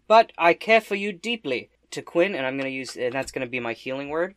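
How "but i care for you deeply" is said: higher, louder declaration of care; hammed-up heartfelt line; in-character affection. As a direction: declare affection theatrically to an ally.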